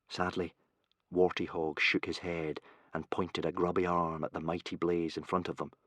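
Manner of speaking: ordinary delivery, nothing marked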